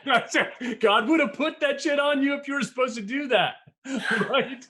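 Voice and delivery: high, loud, laughing through the words; laughing rant. Real, not acted. Mocking wingsuit flyers to his co-host, laughing high and loud. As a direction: crack himself up as he preaches it.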